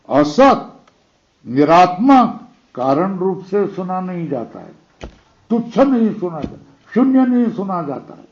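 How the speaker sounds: ordinary talk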